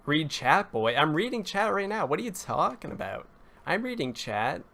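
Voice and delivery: high-pitched exasperated protest; indignant. Real, not acted. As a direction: protest in disbelief, pitch jumping up. Indignant reply to a chat message.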